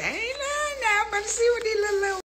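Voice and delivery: squeaky high sassy voice; high mocking voice. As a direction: pitch way up and mock them.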